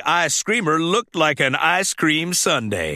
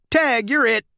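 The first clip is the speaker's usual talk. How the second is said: Mater tagging; 7 semitones higher than usual. Acted. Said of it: "said Mater".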